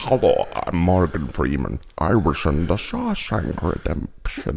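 low, slow impression slipping into Scottish; a badly done deep film-star voice, oddly Scottish; Morgan Freeman. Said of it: "why does he start off Scottish?".

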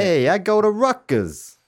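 put-on slow, loud Jersey accent. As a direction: play a proud New Jersey guy. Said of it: "what's a Jersey accent again".

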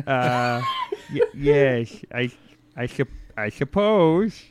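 high, hesitant, stammering agreement; nervous stammer. Acted. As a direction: stumble over it, unsure and uneasy. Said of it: "he's like".

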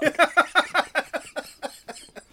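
a long, high giggle; real giggling fit; high laugh. Real, not acted. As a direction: laugh at your own punchline. Laughing after the punchline.

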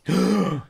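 mock gasp. Discovering a nuclear bomb.

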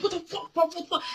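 high, soft disbelief; mock outrage. Retelling her outrage at him, high and soft. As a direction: gasp it out high.

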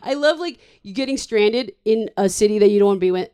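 ordinary talk, nothing marked

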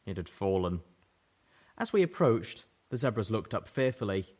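usual speaking voice

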